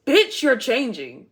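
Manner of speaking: loud, slow sassy call-out; sassy shout. Acted. Reader hyping up the viewer, sassy shout.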